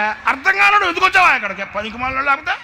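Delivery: furious high shouting rant; angry scolding. Retold or imitated. Preacher scolding the boy in a rage, shouting high. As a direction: tear into him at the top of your voice.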